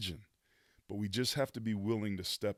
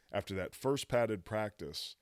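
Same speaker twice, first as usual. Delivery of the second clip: ordinary talk, nothing marked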